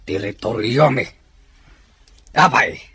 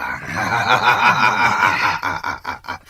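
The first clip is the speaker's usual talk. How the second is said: villain laugh. Acted. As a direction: laugh down at the captive chief.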